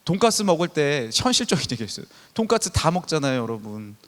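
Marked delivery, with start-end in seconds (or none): none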